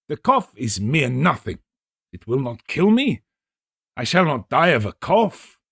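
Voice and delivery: the stubborn guest laughing off danger; proud Fortunato, dismissive. Acted. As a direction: wave away the cough with pride. Fortunato brushing off his cough.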